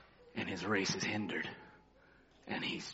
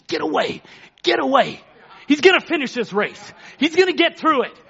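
protective father; 12 semitones higher, 13 dB louder than usual. Retold or imitated. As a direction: start muttered, then build until you're shouting them off. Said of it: "what his daddy was doing".

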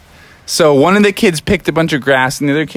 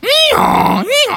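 very high squeaky meow; donkey meowing to the kids; high silly meow. Acted. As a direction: meow like a confused cartoon animal.

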